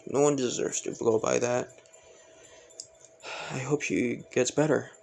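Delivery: sighing, understated sympathy; a touch of sadness, acted gently; hurt for her. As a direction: let out a sigh, then speak with mild sorrow. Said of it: "kind of feeling hurt for her".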